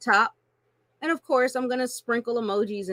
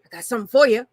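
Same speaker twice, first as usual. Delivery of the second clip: pushy stranger; 7 dB louder, faster than usual. Retold or imitated. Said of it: "somebody stops you ... and says".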